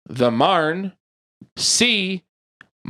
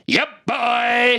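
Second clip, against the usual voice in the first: shouted catchphrase; 8 semitones higher than usual. Acted. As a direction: yell the catchphrase out.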